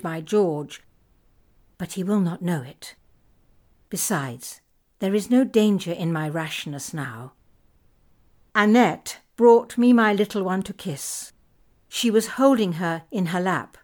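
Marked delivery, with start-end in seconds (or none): none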